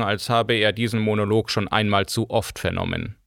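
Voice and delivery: usual speaking voice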